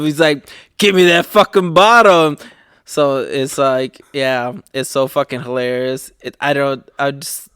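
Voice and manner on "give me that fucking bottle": louder, higher mock demand; passive aggressive. Baby demanding his night bottle, mock aggressive. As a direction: imitate a baby demanding, loud and pushy. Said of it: "super passive aggressive".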